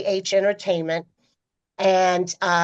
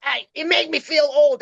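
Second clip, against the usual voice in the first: lightly voiced older star, higher and gruff; a hint of a bristling rock legend; 8 semitones higher than usual. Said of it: "And he goes".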